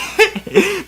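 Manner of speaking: can't hold the laugh in; high giggle. Real, not acted. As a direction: burst of high-pitched giggling. Teller cracks up.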